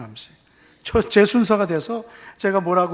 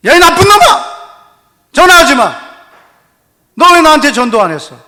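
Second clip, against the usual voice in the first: mock furious yell, much higher and louder; angry shout; 9 semitones higher, 14 dB louder than usual. Pastor reenacts scolding old classmate on phone, loud mock anger. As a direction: scold the friend at full volume, half joking.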